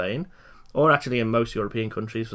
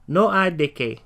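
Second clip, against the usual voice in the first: ordinary talk, nothing marked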